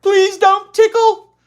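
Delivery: put-on high, slow, pleading child voice; begging little girl. A narrator's reading. Ramona begs her sister not to tickle, high pleading. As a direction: beg like a scared little sister. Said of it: "she begged".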